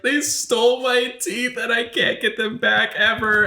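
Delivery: slow, high-pitched mock wail; imitated hysterical crying. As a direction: imitate a distraught sister wailing. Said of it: "She was hysterical. She was crying".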